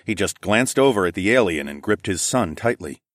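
ordinary delivery, nothing marked

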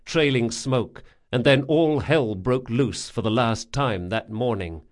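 ordinary delivery, nothing marked

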